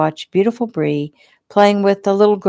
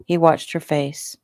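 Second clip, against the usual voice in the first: ordinary delivery, nothing marked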